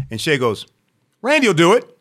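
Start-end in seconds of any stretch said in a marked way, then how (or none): 1.2-1.9 high, loud offer; raised voice, offering someone up; volunteering a friend. Retold or imitated. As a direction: pipe up loudly on a friend's behalf. Shay volunteers him to club owner.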